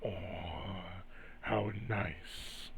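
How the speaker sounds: quiet, drawn-out mock delight; slow, softly savoured and teasing; red shadow hand. Shadow entity taunting Graves as he reaches out, soft drawl. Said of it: "and says".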